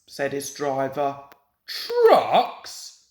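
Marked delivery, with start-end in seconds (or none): 1.7-3.1 loud, high cross question. A narrator's reading. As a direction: snap it back crossly. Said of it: "said Gordon crossly".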